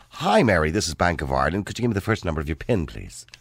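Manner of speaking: neat, proper Irish bank-official voice; polished Irish accent. Acted. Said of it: "or would you trust".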